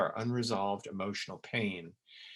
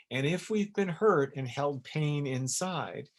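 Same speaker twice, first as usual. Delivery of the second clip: normal talking voice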